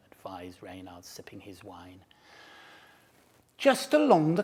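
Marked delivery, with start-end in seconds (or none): 0.1-2.0 soft unvoiced whisper. A narrator's reading. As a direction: breathe it quietly. Narration aside.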